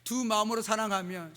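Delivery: ordinary talk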